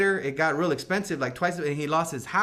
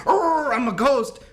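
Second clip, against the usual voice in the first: mock spooky ghost; 8 semitones higher, 6 dB louder, slower than usual. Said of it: "He didn't get all like".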